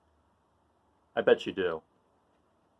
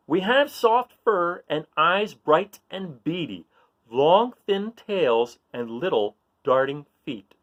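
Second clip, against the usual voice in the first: put-on slow, sing-song riddle reading for kids; reading riddle aloud; 5 semitones higher, 4 dB louder, slower than usual. Reading a riddle to young listeners, slow and playful. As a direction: read it slowly to the children, savouring each clue. Reads mouse riddle to children.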